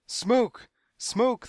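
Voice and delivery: high, loud cry of panic; hysterical yell. A narrator's reading. Waiting room panics over smoke, hysterical yelling. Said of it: "they yelled hysterically".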